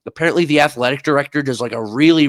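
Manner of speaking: usual speaking voice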